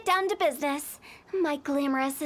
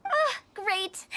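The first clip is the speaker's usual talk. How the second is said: ordinary talk, nothing marked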